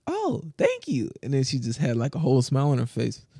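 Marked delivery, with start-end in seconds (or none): 0.1-1.1 flattered reply. Retold or imitated. Retelling her thanks for a compliment, high and flattered. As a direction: imitate her delight, slow and high.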